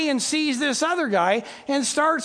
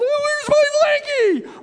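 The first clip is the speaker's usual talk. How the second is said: put-on wailing child; 5 dB louder than usual. Child missing a favorite blanket, loud acted wailing. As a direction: wail like an inconsolable kid. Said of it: "she is wailing".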